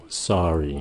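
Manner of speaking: SORRY low and soft, falling. Shown as a demonstration. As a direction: drop the voice right down on "sorry". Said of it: "Nhớ xuống giọng".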